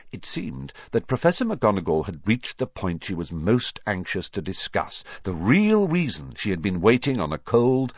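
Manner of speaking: usual speaking voice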